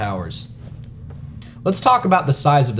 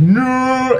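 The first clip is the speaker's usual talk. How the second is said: mock shout; 11 semitones higher, 4 dB louder than usual. Seeing buddy run past grenade.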